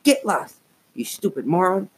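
harsh raspy snarl. A narrator's reading. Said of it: "he snarled in a harsh, raspy voice".

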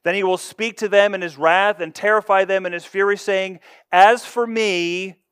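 loud, forceful sermon reading; booming preacher delivering the psalm; preaching. Acted. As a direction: proclaim it from the pulpit.

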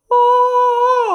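high loud wail of oh; mock dramatic cry. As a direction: throw out a big theatrical oh. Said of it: "He's all".

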